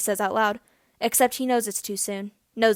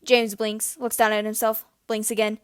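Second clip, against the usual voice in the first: ordinary delivery, nothing marked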